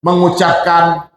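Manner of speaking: ordinary delivery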